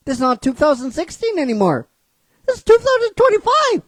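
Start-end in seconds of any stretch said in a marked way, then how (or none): 2.5-3.8 higher and louder, hammering it home; emphatic year. Real, not acted. Lecturing studios about the year, loud emphatic. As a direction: drive the point home, loud and high.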